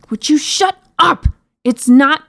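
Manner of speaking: high, loud exasperation; furious princess outburst; screamed. A narrator's reading. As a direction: yell in high-pitched annoyance. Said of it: "the princess screamed".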